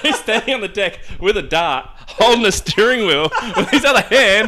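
laughing hard at the memory; laughing while telling. Real, not acted. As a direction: crack up mid-story. Laughing through the dart story.

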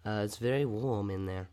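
normal talking voice

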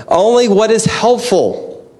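usual speaking voice

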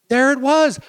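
high, loud burst of discovery; sudden high excited exclamation; excited reveal. Real, not acted. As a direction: break out as if it just dawned.

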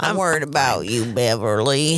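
creepy movie dad. Retold or imitated. Quoting Beverly's creepy dad from It in a deep voice. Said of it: "Uh, It".

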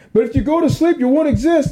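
Joe Rogan. Acted. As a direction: shout a dire warning, half laughing. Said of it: "Joe Rogan was in my head".

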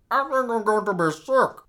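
usual speaking voice

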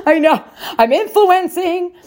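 high, laughing self-mockery; giggling brag. Real, not acted. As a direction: laugh it out, pleased with yourself. Laughing at her own influence.

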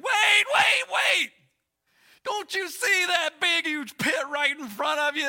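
high, frantic mock alarm; urgent warning shout. Guide halting a boy before an imagined pit, urgent shout. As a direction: stop him short with a loud yell.

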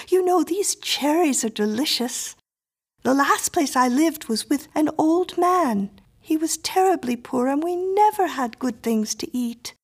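put-on high, self-satisfied child voice; chatty little girl. A narrator's reading. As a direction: prattle on as a girl deaf to protest, pitched high. Miriam chats on, ignoring the plea.

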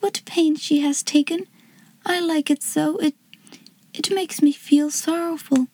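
put-on hushed voice of a grateful girl; warm feeling. A narrator's reading. Girl moved by a homemade birthday present, warm feeling. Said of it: "with the warm feeling in her heart".

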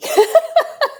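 bright high burst of laughter; real laugh. Real, not acted. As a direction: laugh freely at the story. Host laughs at retold tease.

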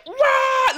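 short, high "rah" yell; wild high-pitched war cry; battle roar. Acted. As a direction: let out a mock fighting roar. Acting crazy to fight.

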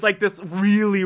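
ordinary delivery

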